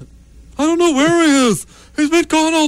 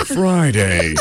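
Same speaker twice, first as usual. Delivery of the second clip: very low, deep announcer word; title-card announcement. Acted. Announcing the next day of the story, deep. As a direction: intone it far below your usual pitch.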